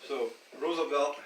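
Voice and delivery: ordinary talk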